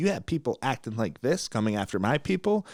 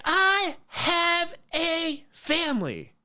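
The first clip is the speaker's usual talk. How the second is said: imitating her crying voice; much slower than usual. The recruiter sobbing that he is mean, high slow mock wail. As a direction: wail it like a hammed-up crier. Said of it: "She's like".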